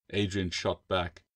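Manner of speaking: plain reading voice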